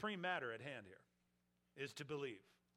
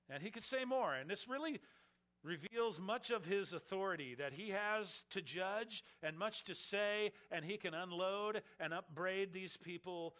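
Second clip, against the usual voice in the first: much higher, louder fervour; fervent preaching; 5 semitones higher, 6 dB louder than usual. Acted. Preacher extolling Christ's authority, high and loud. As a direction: lift it up with passion.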